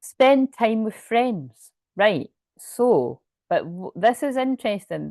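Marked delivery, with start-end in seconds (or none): 0.0-1.7 slow, higher, deliberate reading; slow card reading. Shown as a demonstration. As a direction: read each word out slowly. Reading the card's message aloud.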